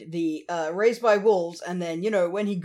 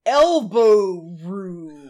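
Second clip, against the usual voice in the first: very slow, each word stretched out; spaced out words; 7 dB louder, much slower than usual. Shown as a demonstration. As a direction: drag it out letter by letter. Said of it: "imagine this in spaced out letters".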